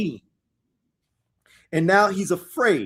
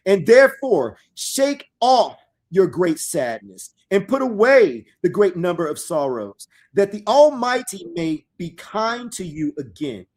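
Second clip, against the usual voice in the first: slow, loud, solemn reading of scripture; dramatic scripture reading; 2 semitones higher, 5 dB louder than usual. Acted. Preacher reading prophecy aloud to his listeners, solemn and loud. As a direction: proclaim the verses slowly to the congregation.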